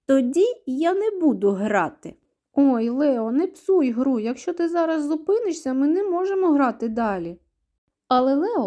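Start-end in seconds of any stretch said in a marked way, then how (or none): 0.0-2.2 higher, louder defiant refusal. A narrator's reading. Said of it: "Мишенятка зухвала схрещує лапки і каже". 2.5-7.4 high, raised-voice urging; pleading protest. A narrator's reading. Друзі вмовляють ображеного Лео.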